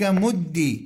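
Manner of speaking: "مد" ending in "i", muddi; kasra ending. Shown as a demonstration. Teacher reading the last allowed imperative, "مد" with kasra. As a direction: say "مد" with doubled d and "i". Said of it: "نفس هذه الأحكام تجري في الأمر".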